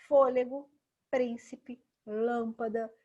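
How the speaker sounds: Fôlego, príncipe, lâmpada, each punched loud on its first syllable; first-syllable stress. Shown as a demonstration. Teacher giving examples of proparoxítonas, first syllables punched loudly. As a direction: hit FÔ, PRÍN and LÂM hard in Fôlego, príncipe, lâmpada. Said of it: "todas as proparoxítonas são acentuadas".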